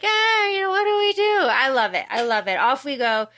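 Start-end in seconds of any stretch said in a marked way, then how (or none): 0.0-1.4 high, coaxing mock persuasion; hammed-up wheedling scientist; persuasive alien. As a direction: talk them into it, shrugging. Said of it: "He's like, he's very persuasive".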